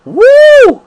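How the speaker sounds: a high, loud whoop of joy; excited whoop. Real, not acted. Worshipper whooping at the preacher's joke, excited whoop. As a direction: let out a delighted whoop. Shout after the Martha impression.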